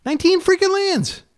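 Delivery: very high hysterical squeal. Real, not acted. Player rechecks the land count in his deck, hysterical. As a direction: squeal it in exasperated disbelief. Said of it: "hysterical sounding voice".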